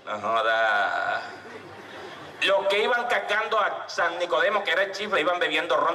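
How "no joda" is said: mild mock grumble. Chifla sweating under the sun.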